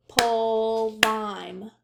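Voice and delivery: "Pulvime" in two clapped beats, pul and vime; clapped syllables. Shown as a demonstration. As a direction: clap it out slowly. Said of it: "clap it".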